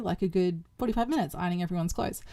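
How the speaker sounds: usual speaking voice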